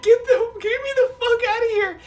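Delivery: high-pitched panicked squeal, near crying; frantic. Retold or imitated. Swimmer fleeing orcas in a nightmare pool, frantic high squeal. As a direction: plead frantically to get out, voice way up high. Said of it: "And I'm, like, frantically".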